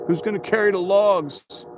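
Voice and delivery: high-pitched parody of a motivational catchphrase; David Goggins. Retold or imitated. Streamer parodying a motivator for viewers, high catchphrase impression. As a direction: lift the pitch, mock hype challenge. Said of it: "about David Goggins".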